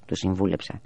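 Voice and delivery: ordinary delivery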